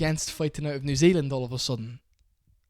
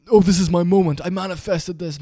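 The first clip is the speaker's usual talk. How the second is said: loud, high-pitched mock self-importance; mocking imitation; 5 semitones higher, 9 dB louder than usual. Critic mocking the fighter's main character syndrome, loud mocking imitation. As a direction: mimic a self-absorbed star, high and loud.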